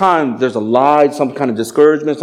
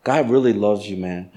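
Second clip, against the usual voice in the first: soft sincere; 5 semitones lower, 6 dB quieter than usual. Retold or imitated.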